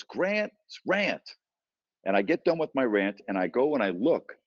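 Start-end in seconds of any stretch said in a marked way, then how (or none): 0.0-1.2 retold yell. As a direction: imitate a passer-by calling out. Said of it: "someone yells from behind me".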